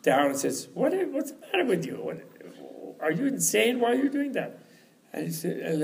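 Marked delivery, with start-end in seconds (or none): 0.7-4.6 high, incredulous scolding; Tilopa. Acted. Teacher scolds the disciple who jumped, high incredulous voice. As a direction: imitate a teacher baffled at his disciple, pitched way up. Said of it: "And then Tilopa comes down and says".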